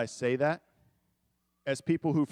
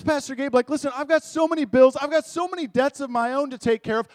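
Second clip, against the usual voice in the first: loud, higher, indignant objector; defensive churchgoer; 9 semitones higher, 7 dB louder than usual. Retold or imitated. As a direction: push back on the pastor, louder and higher. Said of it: "Or, or plus".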